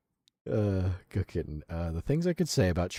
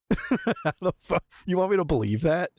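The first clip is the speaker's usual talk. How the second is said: much higher, laughing scoff; laughing disbelief; 9 semitones higher than usual. Real, not acted. Streamer scoffing at the video's claim, laughing disbelief. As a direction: laugh it off as absurd.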